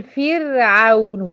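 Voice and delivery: فرعون with a thick, full ر, slowed and loud; demonstrating the wrong heavy ra in فرعون; wrongly heavy ra. Shown as a demonstration. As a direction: say فرعون with the ر made heavy on purpose. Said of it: "والصح إن الر هنا مرققة".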